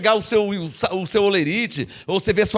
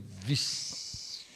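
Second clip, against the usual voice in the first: stunned vixe; 16 dB quieter than usual. Retold or imitated. Stunned reaction retold.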